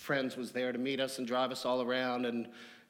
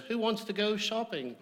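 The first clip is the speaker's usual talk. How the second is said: put-on Australian accent; 8 semitones higher than usual. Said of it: "I can't do the accent".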